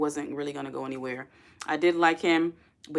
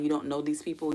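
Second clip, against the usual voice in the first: ordinary talk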